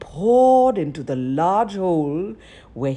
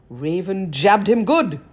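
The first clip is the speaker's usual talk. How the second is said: plain reading voice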